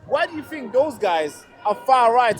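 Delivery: ordinary talk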